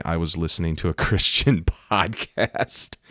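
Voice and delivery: slow, teasing echo with a laugh; mocking quote. Preacher ribbing her podcast excuse, mocking and amused. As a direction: drag it out sarcastically, laughing. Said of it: "that's real smart. Hit somebody with your car and say".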